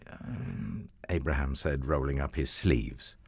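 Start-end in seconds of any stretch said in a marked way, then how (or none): none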